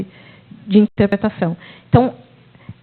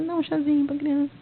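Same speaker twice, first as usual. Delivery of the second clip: soft reassurance; 5 semitones higher, 9 dB quieter than usual. Retold or imitated. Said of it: "né?".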